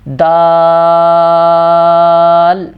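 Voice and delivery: دال held long, tongue on the palate; drawn-out recited دال; long daal. Shown as a demonstration. Teacher reciting دال slowly for the class. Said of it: "دال پڑھتے ہوئے بھی".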